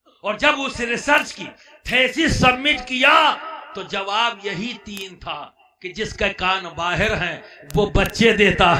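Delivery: very loud, hammered rhythmic recital; full-force preaching. Real, not acted. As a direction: drive each answer home at a shout. (گرجدار، پرجوش خطیبانہ انداز)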